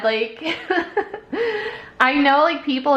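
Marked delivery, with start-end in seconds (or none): 0.5-1.8 a burst of high laughter. Real, not acted. Laughing while telling a friend about the groomer. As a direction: laugh out at the memory.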